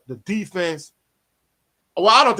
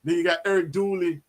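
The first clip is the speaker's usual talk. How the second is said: ordinary delivery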